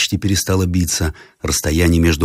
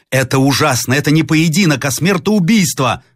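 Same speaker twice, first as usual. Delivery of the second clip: outraged protest; 8 semitones higher, 3 dB louder than usual. A narrator's reading. Roslavlev protesting the duel.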